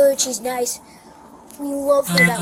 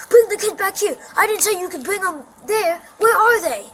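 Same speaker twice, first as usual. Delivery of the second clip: retold angry yell; 7 semitones higher, 6 dB louder than usual. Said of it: "she's like".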